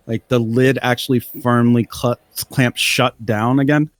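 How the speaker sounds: ordinary delivery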